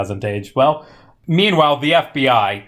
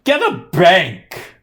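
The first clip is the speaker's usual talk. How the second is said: exasperated shout; 6 semitones higher, slower than usual. Real, not acted. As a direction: yell it at the film. Mock exasperation at the plot.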